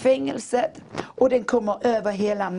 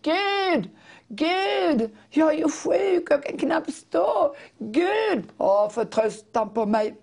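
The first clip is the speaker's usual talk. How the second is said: high, drawn-out plea to God; pleading prayer; 6 semitones higher, much slower than usual. Retold or imitated. Speaker cries out to God all night, high and pleading. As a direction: beg God through the night, voice lifted high.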